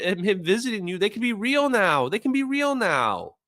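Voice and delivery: earnest pleading. Retold or imitated. Pleading his wormhole case.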